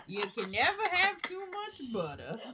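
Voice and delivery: hammed-up southern cook; mock southern cook. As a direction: preach butter like a cooking-show hostess. Continuing the Paula Deen bit.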